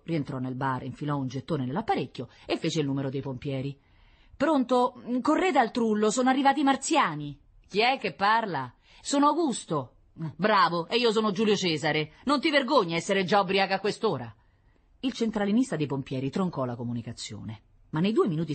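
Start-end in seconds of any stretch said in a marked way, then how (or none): 4.4-7.4 higher, louder hurried voice; breathless call for help; panicked waiter on the phone; urgent phone call. Acted. Augusto calls the firemen. 7.7-14.3 mocking operator. Firemen's operator mocks.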